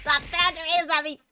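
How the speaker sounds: fast, high, loud playful protest; playful yell. Real, not acted. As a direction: yell it at chat, laughing. Reacting to chat throwing tomatoes.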